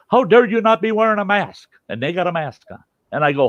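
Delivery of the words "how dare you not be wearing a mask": high, loud mock outrage; a stranger in the store scolding Sty, mock outraged; mock indignant accuser. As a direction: play the scandalised stranger, pitched way up. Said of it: "somebody walks up to you and says".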